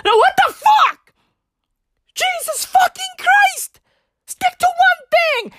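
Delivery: high-pitched, incredulous outrage; exasperated outburst. Acted. Cutting off the singer girls' act, high exasperated outburst. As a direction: explode in high-voiced exasperation.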